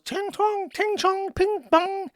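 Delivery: very high, sing-song mock Chinese gibberish; mock Chinese accent. Wife mocked by the vlogger, high sing-song mock Chinese accent. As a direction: caricature a Chinese accent in high nonsense syllables. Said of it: "she's, like, Chinese and just goes".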